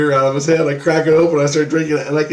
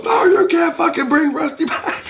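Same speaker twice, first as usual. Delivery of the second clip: mock shout. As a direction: yell it like Jesus has had enough. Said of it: "And he goes".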